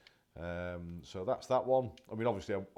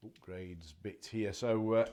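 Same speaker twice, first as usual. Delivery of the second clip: ordinary talk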